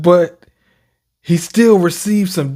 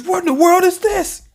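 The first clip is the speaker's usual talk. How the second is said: high shocked voice; 14 semitones higher than usual. Retold or imitated.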